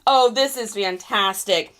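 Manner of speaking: ordinary delivery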